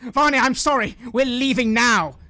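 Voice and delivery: loud, high, disgusted exit line; indignant. Acted. Woman glares at the group, indignant and leaving. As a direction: huff at them and leave. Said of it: "glares at all of you and just says".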